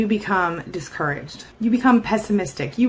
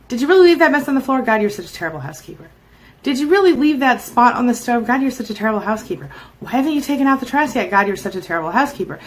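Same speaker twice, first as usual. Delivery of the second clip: quick, exasperated scolding; mock nitpicking tirade; acted nagging criticism; 4 semitones higher, 4 dB louder, faster than usual. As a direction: scold in rapid bursts, each jumping high and loud at the start. Voicing a narcissist nagging about housekeeping.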